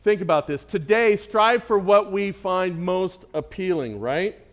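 closing sermon push, loud at first then settling; preaching exhortation. Acted. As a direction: urge the fathers from the pulpit.

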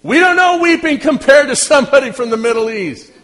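high, loud preacher's outburst; emphatic exclamation. Real, not acted. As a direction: belt it out to the whole congregation. Preacher exclaiming about intense weeping.

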